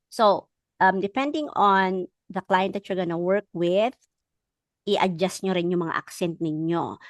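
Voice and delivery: ordinary talk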